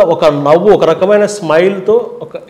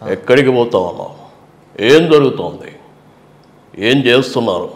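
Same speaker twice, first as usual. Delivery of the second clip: Chandrababu eyes style; 6 semitones lower, 4 dB quieter than usual. Retold or imitated. Chandrababu Naidu impression with his press-meet look. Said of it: "ఇట్లా ఉంటుంది".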